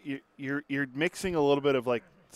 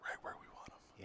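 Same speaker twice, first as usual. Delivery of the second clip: whisper; 23 dB quieter than usual. Acted. Whispered confident reply.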